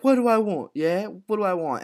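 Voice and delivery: high-pitched mock questioning voice; imitating Ada. Co-host quoting Ada's comeback to Tommy, high mock voice. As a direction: throw it out high and loud, then ease off.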